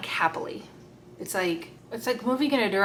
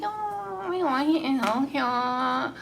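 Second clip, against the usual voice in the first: high, swooning lovesick sigh, much higher; mock schoolgirl crush; 6 semitones higher, much slower than usual. Teasing a crush-struck client as a swooning schoolgirl, high and breathy. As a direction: gush like a smitten schoolgirl. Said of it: "kinda like a schoolgirl crush".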